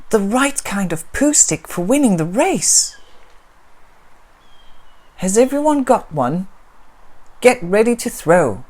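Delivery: put-on high, loud eager little girl; bubbling over with excitement; cheerful child voice; excited girl. Girl speaks.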